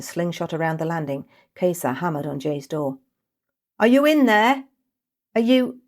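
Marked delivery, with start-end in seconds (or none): 3.8-5.8 high, loud worried calls; frantic calling. Acted. Kesa hammering on Jay's door, panicked calling. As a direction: call through the door in panic.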